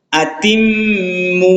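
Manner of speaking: আতিম্মু read slowly, doubled ম্ম hummed long; slow recitation of আতিম্মু; held nasal ghunna. Shown as a demonstration. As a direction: hum the ম্ম through the nose, then draw out the vowel. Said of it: "গুণা করে পড়তে হবে".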